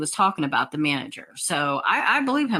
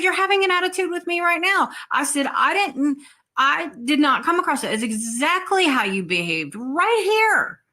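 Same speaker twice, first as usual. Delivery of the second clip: higher, firm indignant comeback; indignant retort; 8 semitones higher than usual. Retold or imitated. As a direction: put her in her place. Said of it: "And I said".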